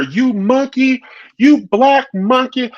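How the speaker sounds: high-pitched mock yell; Erica Mena. Re-enacting the show preview's insult, as a high mock yell. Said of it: "the preview showing her".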